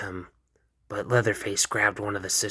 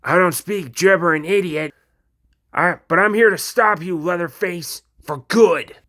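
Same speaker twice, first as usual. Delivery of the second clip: much higher, loud, defiant yell; angry shout; 8 semitones higher, 8 dB louder than usual. Acted. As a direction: yell it at the monster. Denji confronts Leatherface.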